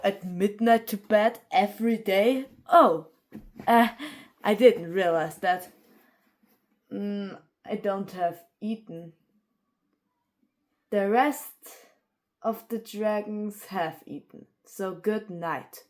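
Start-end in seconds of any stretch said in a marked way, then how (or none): none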